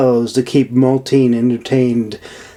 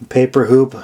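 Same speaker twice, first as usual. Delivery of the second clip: usual speaking voice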